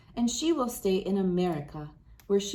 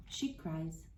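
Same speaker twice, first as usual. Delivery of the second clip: soft sad; 4 semitones lower, 12 dB quieter than usual. A narrator's reading. Sad turn in picture book.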